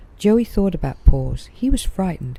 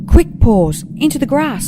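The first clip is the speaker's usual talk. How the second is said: high, loud scream; terrified young koala screaming at his friend; 10 semitones higher, 8 dB louder than usual. A narrator's reading. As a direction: scream the warning to get your friend to safety. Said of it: "screamed Joey".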